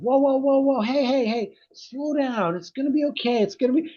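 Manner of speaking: high, half-acted soothing of an upset kid; hushing a crying child. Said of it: "and I'm shutting him down".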